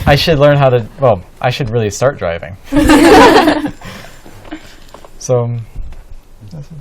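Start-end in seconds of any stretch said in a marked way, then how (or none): 2.6-5.1 loud high laugh. Real, not acted. Laughs at not driving yet.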